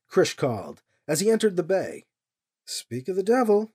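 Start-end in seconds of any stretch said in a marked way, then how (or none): none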